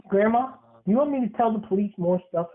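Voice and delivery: ordinary delivery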